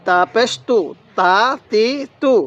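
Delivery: normal talking voice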